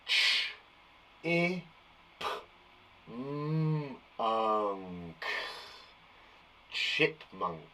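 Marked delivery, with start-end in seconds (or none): 0.1-5.2 Ch, i, p, m, unk quietly sounded one by one; segmenting sounds. Shown as a demonstration. Teacher sounding out chipmunk for the class. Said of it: "I'm gonna try and sound this one out".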